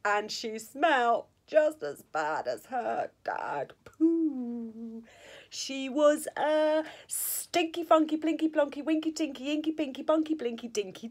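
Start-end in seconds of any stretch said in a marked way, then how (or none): none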